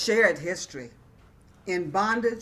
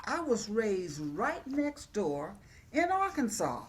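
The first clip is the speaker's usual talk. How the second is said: ordinary delivery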